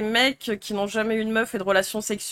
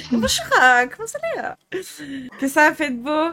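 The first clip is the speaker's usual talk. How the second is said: posh voice; 3 semitones higher than usual. Acted. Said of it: "Elle passe du beauf à la pique-me".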